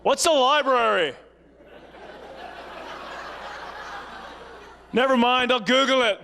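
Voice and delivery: imitating a teen shouting back, amused; clueless teen. Teen answers loudly.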